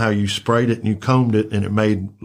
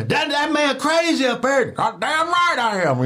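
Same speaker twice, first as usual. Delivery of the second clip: much higher squeaky street voice; imitating the crackheads and Joe; high mock voices. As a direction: squeal it out in disbelief. Crackhead then Joe reply.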